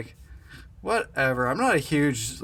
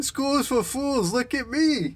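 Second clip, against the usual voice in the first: much higher put-on voice; goofy quote voice; 11 semitones higher than usual. Friend quoting the movie line in a high goofy voice. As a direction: say it like a cocky dropout. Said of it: "you always used to quote".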